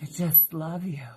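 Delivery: whispered doting pet voice; adoring cat. Pretend. Cat whispering devotion to the husband, whispered. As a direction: breathe it like a smitten cat.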